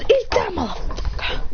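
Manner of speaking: high, strained battle cry; fighting taunt. Acted. Fighter kicking Madara down, high-pitched taunt. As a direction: throw the taunt out high with the blow.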